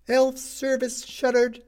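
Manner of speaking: high, slow street-hawker cry; newsboy crying the headline to passing crowds; newsboy shouting. A narrator's reading. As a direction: call it out like a paper boy selling headlines.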